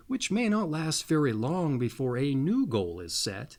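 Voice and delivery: ordinary delivery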